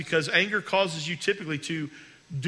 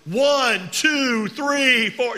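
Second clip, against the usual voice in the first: hammed-up yelled count, much higher and louder; mock shouted counting; 7 semitones higher, 7 dB louder, much slower than usual. Pastor mocks a spouse counting loudly at a partner. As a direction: bark the numbers at your spouse as a joke. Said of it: "No, don't do that".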